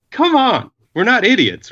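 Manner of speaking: high, scoffing impatient cop; exasperated cop. Retold or imitated. Cop losing patience with friends.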